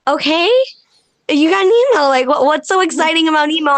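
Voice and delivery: retold husband, speeding up at the end; puzzled husband. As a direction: imitate an unimpressed husband asking why it matters. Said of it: "And he's like".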